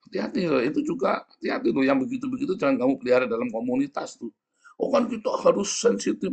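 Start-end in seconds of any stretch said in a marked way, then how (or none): 4.8-6.3 mocking sensitive member.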